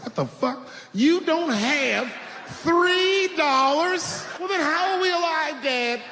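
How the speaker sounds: put-on high, slow, incredulous kid; outraged disbelief. Twelve-year-old asking his broke dad, high and outraged. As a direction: stretch it out in stunned disbelief. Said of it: "I was like".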